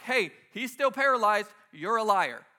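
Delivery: mock accusation. Onlooker calling out a failed healing, mock accusation. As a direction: call out the fraud loudly. Acted crowd accusing healer.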